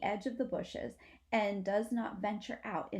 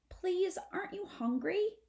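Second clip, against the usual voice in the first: pleading possum; 11 semitones higher than usual. Acted. Antonio pleads with sister.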